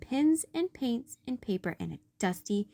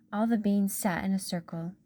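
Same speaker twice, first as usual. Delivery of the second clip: ordinary delivery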